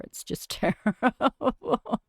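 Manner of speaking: breaks into a soft exasperated laugh; laughing in frustration. Real, not acted. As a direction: laugh it out, fed up.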